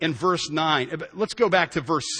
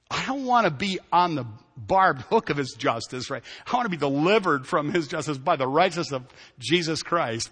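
usual speaking voice

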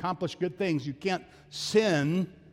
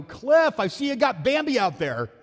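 loud, much higher, bright greeting to a friend; retold cheery call-out; 7 semitones higher, 5 dB louder than usual. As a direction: call it out across the room, cheerfully clueless. Retelling his own blunder to host.

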